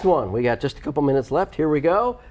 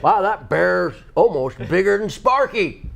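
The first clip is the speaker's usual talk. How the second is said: mountain man voice; 5 semitones higher, slower than usual. Pretend. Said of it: "mountain man impression".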